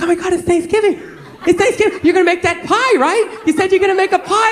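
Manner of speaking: high-pitched, eager imitation; excited girlfriend. Girlfriend waking boyfriend on Thanksgiving, imitated excitement. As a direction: pester brightly in a mimicked girlfriend voice. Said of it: "she ran away all excited".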